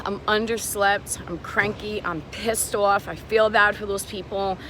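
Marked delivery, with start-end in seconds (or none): none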